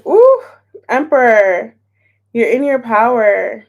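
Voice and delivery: excited card pull; excited reveal. Real, not acted. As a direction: react thrilled to the card.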